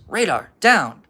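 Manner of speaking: loud, high shout to a dog; yelled command. A narrator's reading. Old guy on the porch to his dog, yelled command. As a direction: call the dog off with a yell. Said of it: "he yells".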